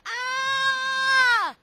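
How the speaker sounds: high loud frightened shriek; yelled ah. Acted. Lady scared by Lance's face, high loud shriek. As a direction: shriek and fall over. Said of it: "The lady yelled".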